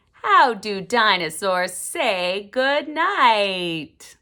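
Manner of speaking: loud, slow title read; big storytime title call; title announcement. Acted. Announcing the book title loudly.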